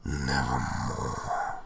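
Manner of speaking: low, very slow, otherworldly croak; raven's answer. Acted. Raven answering the narrator, low, slow and eerie. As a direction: let the raven toll the word slowly and low. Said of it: "Quoth the raven".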